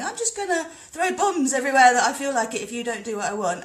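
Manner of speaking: loud, high mocking voice of a swaggering bully; mock bully leader. Mocking a world leader who threatens to throw bombs, loud and sneering. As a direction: sneer it like a muscle-flexing strongman. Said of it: "some guy flexing his muscles, going".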